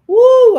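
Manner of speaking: sudden piercing "woo"; loud high whoop. Real, not acted. Preacher tells of taking off running, loud high whoop. Outburst while telling of running off.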